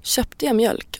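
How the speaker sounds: hushed self-questioning; inner thought. Retold or imitated. Reader distracted by shopping worries, quiet inner voice. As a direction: wonder it softly to yourself.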